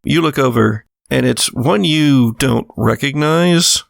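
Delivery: normal talking voice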